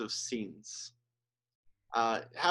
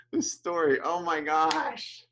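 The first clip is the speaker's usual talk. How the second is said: higher and louder, then slow and strained; overcome; 5 semitones higher, 4 dB louder, slower than usual. Real, not acted. Preacher overcome retelling the sniper story, choked up. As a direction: let the feeling catch you mid-word. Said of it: "I'm so terrible".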